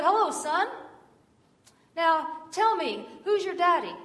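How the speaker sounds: put-on high, loud friendly address to a child; hearty preacher. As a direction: greet the boy heartily, then question him. Preacher greets and questions boy.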